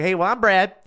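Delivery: high, loud, hearty intro; friendly local Brad. Retold or imitated. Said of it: "He was like".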